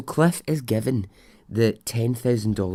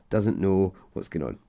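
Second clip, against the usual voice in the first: ordinary talk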